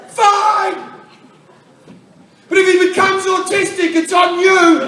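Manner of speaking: very high, loud imitation of a hysterical wife; hammed-up shriek of blame; melodramatic screaming. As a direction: scream it like an overacting actress. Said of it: "just screaming".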